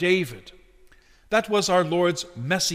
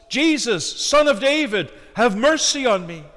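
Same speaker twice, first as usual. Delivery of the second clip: high, loud plea for mercy; preacher's full-voiced cry as a desperate beggar; crying out loudly; 6 semitones higher, 5 dB louder than usual. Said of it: "cried out at the top of his lungs".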